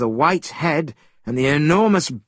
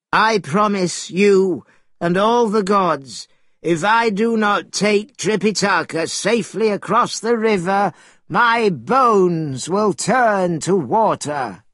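higher, drawn-out turtle voice; solemn turtle oath; 6 semitones higher than usual. A narrator's reading. Turtle swears to Monkey and the gods, solemn and higher. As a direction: swear it solemnly, stretching the last words.

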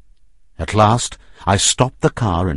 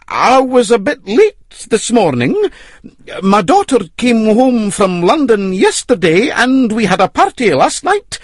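rustic roadman voice; 14 semitones higher, 5 dB louder than usual. Acted. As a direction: play the simple road worker. Hannay disguised as roadman.

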